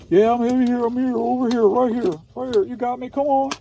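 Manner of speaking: high-pitched, pestering put-on voice; high voice begging to be counted; insistent roll-call pupil. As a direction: insist on being noticed in a high character voice.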